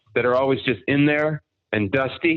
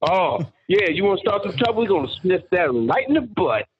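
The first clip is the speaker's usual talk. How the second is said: higher mock drill-sergeant voice; drill sergeant catchphrase; 7 semitones higher than usual. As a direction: parrot the sergeant's mangled line for laughs. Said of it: "I made a career out of that phrase".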